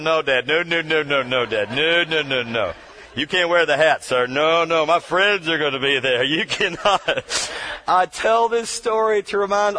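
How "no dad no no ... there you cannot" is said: mock pleading kid. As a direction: lower mock voice of an embarrassed kid begging dad. Kid begging dad, mock voice.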